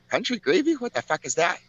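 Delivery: high squeaky mock confusion over gravy; mock baffled northerner. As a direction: play a baffled Yankee who never heard of it. Mocking northerners' puzzlement.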